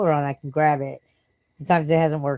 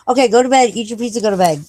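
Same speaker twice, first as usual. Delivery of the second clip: loud send-off; 6 semitones higher, 8 dB louder, faster than usual. Real, not acted. Signing off to a viewer.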